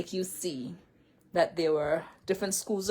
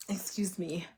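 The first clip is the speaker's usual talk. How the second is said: whispered aside; 7 dB quieter than usual. Real, not acted. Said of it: "aside whisper".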